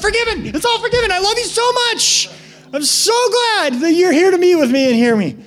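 very high, loud, overjoyed voice; joyful God. Retold or imitated. God welcoming a returning sinner, overjoyed and high. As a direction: burst out with delighted forgiveness. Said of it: "He's like".

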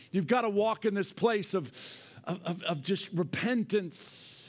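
loud, high fiery preaching. Acted. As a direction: preach it out with full force. Preaching at full force.

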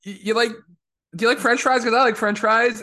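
nervous, fumbling small talk; awkward flirting. Retold or imitated. La'an flirting awkwardly with the lookalike: high, loud. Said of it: "she's just like".

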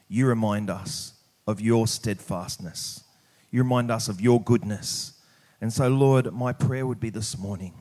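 usual speaking voice

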